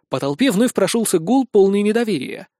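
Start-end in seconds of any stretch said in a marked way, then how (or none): none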